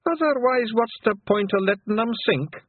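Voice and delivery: high-pitched Pictsy insisting on treasure; Daft Woolly, eager Feegle. Acted.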